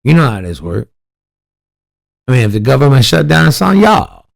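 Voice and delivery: high, loud mock Southern twang, climbing on "y'all"; exaggerated down-home accent jeering at a congresswoman; mocking her campaign drawl. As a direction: parody a politician's folksy speech, pitch shooting up at the end. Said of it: "listen to how she talks".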